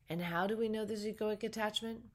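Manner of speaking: ordinary delivery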